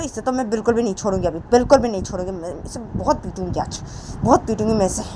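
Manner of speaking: acted temper with sharp questions; angry Poonam. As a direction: rail at her indignantly, punching the threats. Plays Poonam furious, demanding release.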